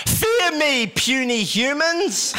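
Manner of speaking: high, slow, grand overlord voice; booming arrogant alien overlord. Pretend. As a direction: lord it over puny humans. Magician's alternative to ta-da.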